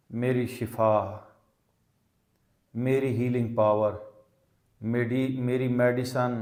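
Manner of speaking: ordinary delivery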